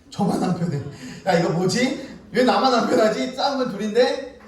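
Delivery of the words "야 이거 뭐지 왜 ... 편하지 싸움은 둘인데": loud, high mock indignation; baffled complaint. Mediator left uneasy after kids' fight, indignant complaint. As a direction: protest the unfairness, pitched up.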